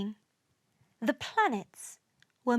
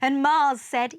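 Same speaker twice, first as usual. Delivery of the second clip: plain reading voice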